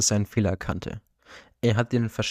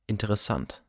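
ordinary delivery

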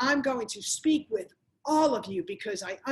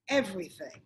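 normal talking voice

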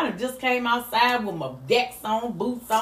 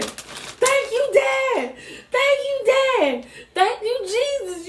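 retold screaming; 10 semitones higher, slower than usual. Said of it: "she was just screaming".

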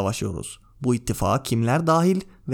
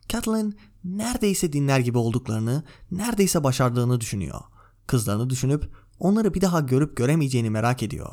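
ordinary delivery, nothing marked

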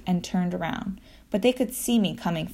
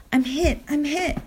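put-on high, panicky cry of a boy; panicked kid voice; 8 semitones higher than usual. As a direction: yelp it like you've been hit. Ashwin cries out when sprayed.

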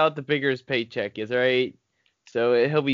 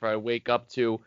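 usual speaking voice

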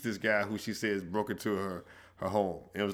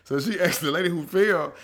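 ordinary talk

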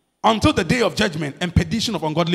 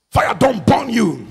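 street slang; 4 dB louder, slower than usual. Acted. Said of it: "I'm talking the language".

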